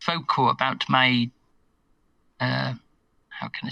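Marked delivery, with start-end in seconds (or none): 3.3-3.7 hushed. Real, not acted. Pompous word choice, hushed.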